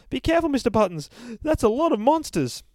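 high, loud, quick fretful warning; a prissy narrator fussing over the bear; fussy narrator. Acted. Narrator frets as the bear descends, high and quick.

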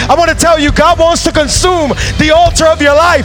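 loud, high, fervent preaching that keeps climbing; full-force preaching. Acted. Pastor urging the congregation to worship intentionally, full-force preaching. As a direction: preach it to the rafters, pushing harder each line.

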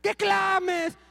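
"QUE clames" agudo y fuerte, con énfasis en QUE; "Que clames" más fuerte. Retold or imitated. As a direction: exhortando a la iglesia a clamar. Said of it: "A veces a la iglesia le decimos". (pastor exhorta a la iglesia a clamar, alzando la voz)

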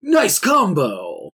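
slow, booming game announcer call; fighting game announcer. Acted. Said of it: "an announcer voice".

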